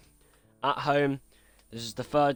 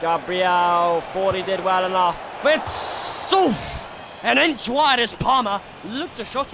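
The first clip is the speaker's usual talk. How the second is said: live football commentator voice; excited stadium commentary; play-by-play commentary; 3 semitones higher than usual. Acted. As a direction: call the match like a TV pundit.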